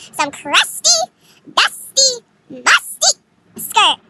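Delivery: loud, slow, punched-out rhyming insult; mocking disdain. Real, not acted. Storyteller ridicules her rival's skirt, loud drawn-out disdain. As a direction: hit each rhyming word hard, stretched out.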